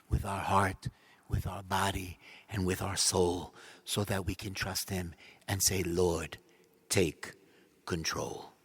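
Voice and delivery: quiet prayerful hush; tender prayer. Acted. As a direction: ease the congregation into surrender.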